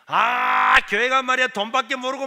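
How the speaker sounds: angry brother. Retold or imitated. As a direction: spit it out like a scornful brother. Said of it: "막 이렇게 욕을 하는 거예요". (교회 욕하는 형의 높고 큰 비난조)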